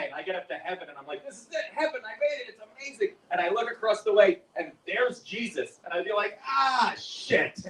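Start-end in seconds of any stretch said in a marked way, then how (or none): 1.2-3.2 acted elation. Imagined arrival in heaven. 6.5-7.6 high, drawn-out groan of realization; acted dismay. Man spotting Jesus in heaven, dismayed groan.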